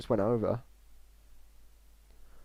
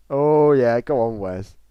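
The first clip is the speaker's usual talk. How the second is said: excited cheer; 3 semitones higher, 14 dB louder, slower than usual. Real, not acted. Cheering on his player.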